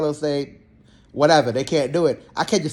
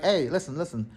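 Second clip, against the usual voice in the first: softer, serious talking-to outside the room; quiet warning; 7 dB quieter than usual. Retold or imitated. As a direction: pull him aside and warn him quietly. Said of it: "I'm like".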